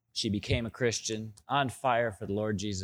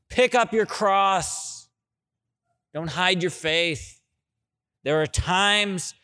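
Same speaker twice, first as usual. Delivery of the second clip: high, loud, full-force preaching; fiery sermon exhortation, higher and louder; 6 semitones higher, 4 dB louder than usual. Acted. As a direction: drive each command home. Preaching the call to action.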